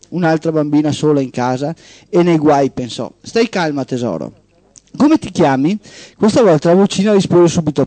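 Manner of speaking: steady radio storytelling voice; host reading a short story on air; story narration. Acted. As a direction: read it like a tale to the listeners. Host reads a story aloud.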